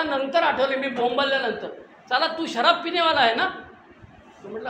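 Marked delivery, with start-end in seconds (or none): 2.1-3.5 higher and louder, an accusing rising question; angry doctor yell. Retold or imitated. Dentist yells at the patient who used to drink, retold accusing shout. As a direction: yell it in the dentist's voice, catching him out.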